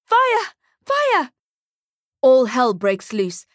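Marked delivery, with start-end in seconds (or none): 0.0-0.5 high panicked scream of fire. A narrator's reading. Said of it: "starts screaming". 0.8-1.3 even higher panicked scream; screaming again. A narrator's reading. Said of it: "starts screaming".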